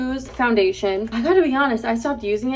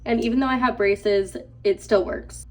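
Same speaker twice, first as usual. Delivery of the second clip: ordinary talk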